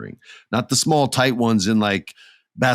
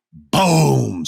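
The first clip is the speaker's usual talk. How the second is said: high, falling boom; sudden booming smash; impact boom; 4 semitones higher, 4 dB louder than usual. Retold or imitated. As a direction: punch out the crash. Imitating bodies hitting the apron.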